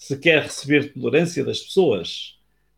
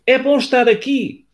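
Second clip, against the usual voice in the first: called out; 9 semitones higher, 4 dB louder than usual. Acted. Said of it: "O pai disse de novo".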